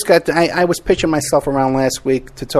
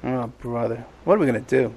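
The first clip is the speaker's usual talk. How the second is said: usual speaking voice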